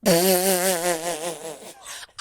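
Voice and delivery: long, falling laugh; real wordless burst of giddy laughter; overwhelmed laugh. Real, not acted. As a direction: let out a helpless laugh of disbelief. Overwhelmed after the big reveal.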